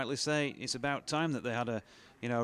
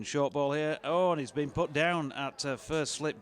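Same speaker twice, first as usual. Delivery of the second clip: raised, urgent commentary; excited commentary; 5 semitones higher, 5 dB louder than usual. Real, not acted. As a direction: call the dropped catch with excitement. Dropped catch, excited commentary.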